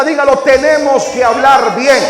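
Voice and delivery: a congregation repeating the line with gusto. Shown as a demonstration. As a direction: say it again with real joy and fire. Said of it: "con más emoción, con más entusiasmo, con más alegría".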